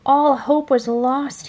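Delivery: ordinary delivery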